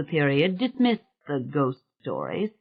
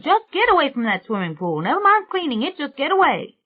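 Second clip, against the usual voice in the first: mild holler; 10 semitones higher than usual. A narrator's reading. Old woman warns men from the pool, mildly hollering. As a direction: call out urgently, pitch climbing. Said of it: "and she hollered".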